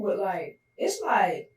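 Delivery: ordinary talk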